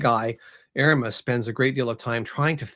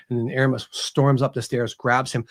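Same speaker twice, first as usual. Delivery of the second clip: ordinary delivery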